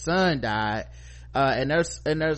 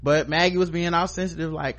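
ordinary talk, nothing marked

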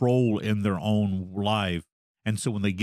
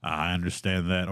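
usual speaking voice

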